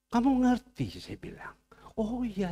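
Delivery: a hushed aside, barely voiced; whisper. Real, not acted. Pastor whispering a confidential aside to the congregation.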